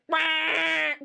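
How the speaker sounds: one long, high "wah" blast; train horn imitation. Host showing what it really is like, train horn imitation. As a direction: hold it like a blaring horn. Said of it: "which really is like".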